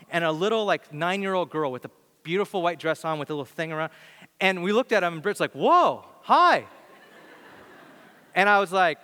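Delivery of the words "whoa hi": surprised greeting. Retold or imitated. Friend greets strangers surprised.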